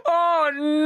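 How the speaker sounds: a high, laughing groan of dread. Real, not acted. Player reacting to Brill's line, laughing.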